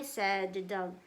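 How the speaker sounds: ordinary delivery, nothing marked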